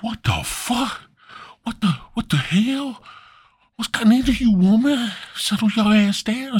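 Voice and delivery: high-pitched, incredulous scolding; put-on high voice demanding an explanation; alarmed Adam. Adam scolds his dancing woman, high and outraged.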